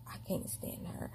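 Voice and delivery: whispered under the breath; whispered complaint. Retold or imitated. Miss Margaret grumbling about her, in a whisper. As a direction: hiss it privately, not meant to be heard.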